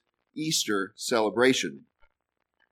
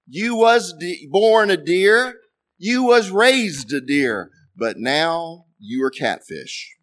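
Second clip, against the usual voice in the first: slow, higher, louder mock ritual chant; slow mock blessing; 4 semitones higher, 4 dB louder, slower than usual. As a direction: drag out the punchline like a solemn blessing. Punchline, Boudreaux blessing the steak.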